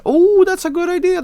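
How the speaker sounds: squeaky high sarcastic voice; mock high voice. Streamer mocking an idea, high mock voice. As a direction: pitch it way up, mocking.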